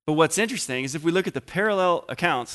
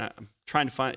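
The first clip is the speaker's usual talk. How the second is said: normal talking voice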